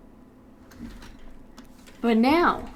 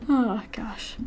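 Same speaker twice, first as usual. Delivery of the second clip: normal talking voice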